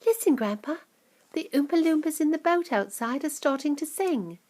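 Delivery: lightly voiced eager hush; boy telling grandpa about the singing; hushed Charlie. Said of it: "whispered Charlie".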